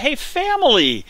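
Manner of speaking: loud, high excited cheer; excited shout. Real, not acted. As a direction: shout it out with glee. Excited about new family.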